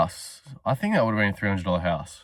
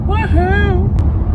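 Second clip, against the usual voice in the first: high loud whoop of joy; excited cheer; 6 dB louder than usual. Real, not acted. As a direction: cheer like you just got paid. Celebrating heading to the pub.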